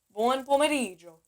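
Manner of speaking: Buon pomeriggio with gio said as one sound like Joe; correct pronunciation. Shown as a demonstration. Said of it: "Almost like you're saying Joe".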